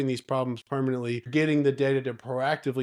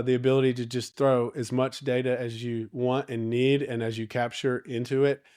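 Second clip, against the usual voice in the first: ordinary talk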